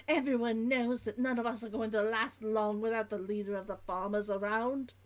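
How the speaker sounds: put-on dropped H's, Old London merchant's log; Old London accent. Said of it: "I'm making it more Old London".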